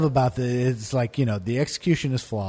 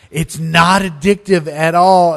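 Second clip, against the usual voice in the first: loud and slow; pushy reassurance; 4 semitones higher, 9 dB louder, slower than usual. Retold or imitated. Stoner insisting to his friend, loud and slow. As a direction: lean hard on it. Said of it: "he's like".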